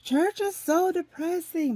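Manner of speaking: high, slow, whiny complaint. Retold or imitated. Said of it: "I used to say".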